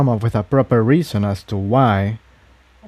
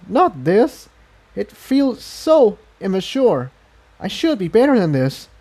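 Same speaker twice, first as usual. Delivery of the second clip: much higher, emotional gratitude; Incendia's voice; 7 semitones higher than usual. A narrator's reading. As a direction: pitch way up, moved and apologetic. Incendia ashamed.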